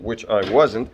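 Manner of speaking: usual speaking voice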